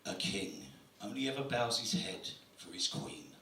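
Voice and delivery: very low, soft, solemn quote; mock profound voice. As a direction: read it like a movie trailer. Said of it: "the quote reads".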